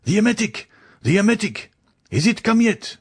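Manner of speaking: high, urgent calls for the emetic; frantic exclaiming. A narrator's reading. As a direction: shout it down the passage in alarm.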